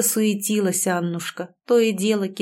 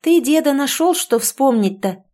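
upset reproach; 6 semitones higher, 5 dB louder than usual. A narrator's reading. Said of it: "огорчённо заметила Тоня".

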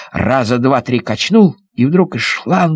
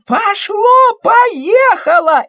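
put-on much higher shrieking girl; joyful shriek; much slower than usual. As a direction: squeal with joy. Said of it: "закричала Алёнка". (радостный визг)

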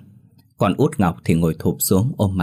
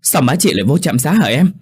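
higher-pitched, worried asking; alarmed question; 7 semitones higher, 4 dB louder than usual. A narrator's reading. Daughter asking why her mother was taken to clinic, alarmed. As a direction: ask in sudden alarm.

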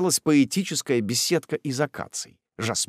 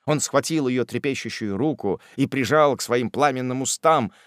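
ordinary delivery, nothing marked

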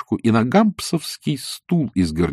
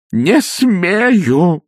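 mumbling priest; 9 semitones higher, 6 dB louder than usual. Acted. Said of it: "забормотал отец Фёдор".

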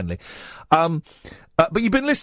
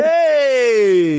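loud happy whoop; excited cheer; 6 semitones higher, 6 dB louder than usual. Real, not acted. Host cheers caller on.

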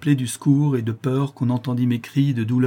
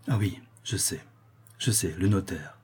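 low, soft, dazed recollection; hesitant realisation; 3 semitones lower, 6 dB quieter than usual. A narrator's reading. Dazed father recalling the notary, hesitant and subdued. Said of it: "il reprit après un moment d'hésitation".

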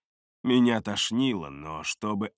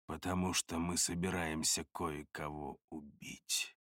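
very soft, low murmur; hushed and low, quietly ominous; quiet menace; 9 semitones lower, 15 dB quieter than usual. A narrator's reading. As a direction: confide a deadly plan under your breath. Narrator tells knife of planned killing.

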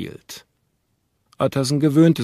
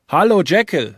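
louder, higher greeting called up to a window; 6 semitones higher, 4 dB louder than usual. A narrator's reading. Lawyer calling up to his friend at the window, louder and higher. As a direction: call up to him at the window. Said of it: "rief er".

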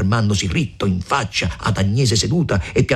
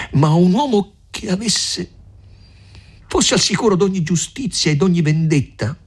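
high-pitched, determined reproach; grieved but resolute; 8 semitones higher than usual. A narrator's reading. Lucia challenges Renzo about their marriage, grieved but resolute. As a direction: press on firmly in a raised pitch. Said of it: "con voce accorta ma risoluta".

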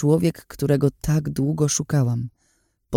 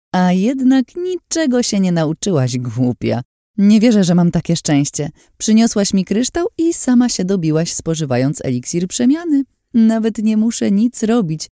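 high, forceful villainess gloat; Lin, cruel and gloating; 7 semitones higher, 3 dB louder than usual. Acted. Lin gloats over the trapped girl, cruelly triumphant. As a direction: crow over the victim, pitched up and lively.

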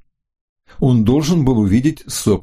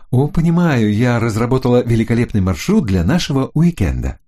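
higher, excited female voice; woman's voice; 4 semitones higher than usual. Acted. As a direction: gush about the weekend. Florinda plans their weekend.

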